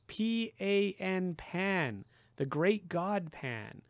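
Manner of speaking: high and slow, each letter hammered home; indignant spelling out. A narrator's reading. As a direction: spell it out, offended and emphatic. Grover indignantly spells out Pan.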